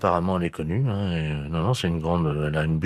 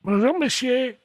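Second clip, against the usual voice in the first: put-on old lady voice; 3 dB louder, slower than usual. Prankster voices the seagull-eating lady.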